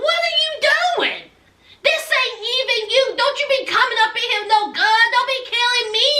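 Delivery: high, panicky mock protest; alarmed pleading. Tied man facing a gun.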